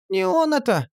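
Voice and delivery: put-on high, mumbled old crone voice; high toothless mumble; mumbling old woman. A narrator's reading. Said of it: "прошамкала Настя".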